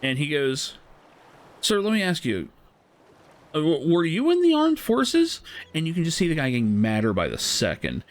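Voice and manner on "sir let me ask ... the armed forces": lightly voiced slick boss, pitched way up; mock slick boss. Retold boss questioning angry customer.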